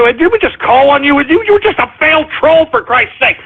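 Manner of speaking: ordinary talk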